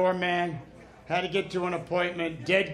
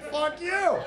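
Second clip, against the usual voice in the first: pitched-up mock outburst; high angry retort; 12 semitones higher than usual. Answering bless your heart, a high mock snap.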